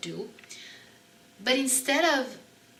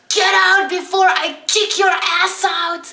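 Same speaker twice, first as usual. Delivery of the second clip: much higher, much louder furious threat; angry threat; 10 semitones higher, 12 dB louder than usual. Retold or imitated. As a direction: bellow it to drive someone out. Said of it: "got so angry that he threatened me".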